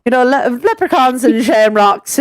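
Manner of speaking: over-the-top Irish brogue. Retold or imitated. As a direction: ham up a leprechaun Irish accent. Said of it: "that accent that I did right there".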